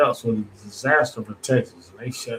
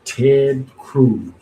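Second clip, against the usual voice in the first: louder, punched disbelief on Ted; scornful emphasis; 2 semitones higher, 6 dB louder than usual. Acted. Host repeats the senator's name to mock him, loud and scornful.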